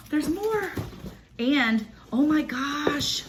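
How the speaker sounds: high squeal, stretching out oh my gosh; excited squeal. Real, not acted. Digging further into the package, squealing excitedly. Finding more items.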